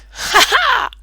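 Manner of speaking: put-on a delighted child's laugh; little girl laughing.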